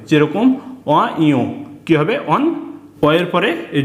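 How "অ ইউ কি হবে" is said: usual speaking voice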